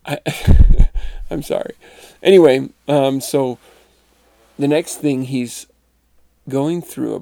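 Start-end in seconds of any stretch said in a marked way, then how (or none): 0.2-1.2 loud helpless burst of laughter; real laugh escaping despite trying not to. Real, not acted. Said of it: "I shouldn't laugh".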